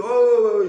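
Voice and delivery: high, loud inarticulate grunting; mock wordless yelps of a man who cannot speak; mute man's noises. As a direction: imitate a mute straining to be understood. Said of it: "soit il fait des bruits".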